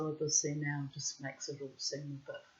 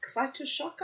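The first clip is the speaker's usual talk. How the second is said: higher, Quite a shock echoed back with a questioning rise; incredulous echo; 10 semitones higher than usual. Retold or imitated. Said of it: "I said".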